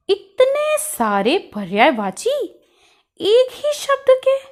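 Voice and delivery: high-pitched, incredulous question; astonished. Acted. Puzzled pupil reacts to so many synonyms for one word, astonished. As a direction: draw out the first words, then rise in disbelief.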